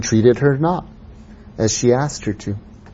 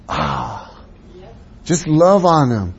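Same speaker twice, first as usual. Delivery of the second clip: normal talking voice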